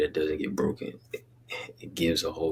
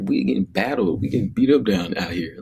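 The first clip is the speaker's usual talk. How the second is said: worked-up exclaim; 6 semitones higher, 6 dB louder than usual. Real, not acted. Emphatic rant about rough play.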